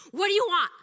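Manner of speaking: loud, high, angry demand; pissed off. Retold or imitated. Older woman answering a stranger's greeting, pissed off. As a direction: imitate an irate stranger lashing out.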